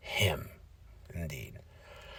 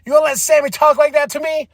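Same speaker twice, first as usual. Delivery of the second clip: cocky wrestler; 8 semitones higher than usual. Retold or imitated. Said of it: "He's like".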